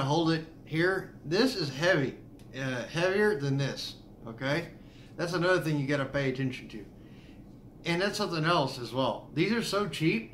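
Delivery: talking around pipe stem; pipe in mouth. Real, not acted. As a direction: teeth clenched on stem. Seen 1: Pipe stem clenched in teeth while talking, briefly removed near 11.9.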